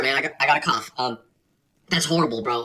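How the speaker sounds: ordinary talk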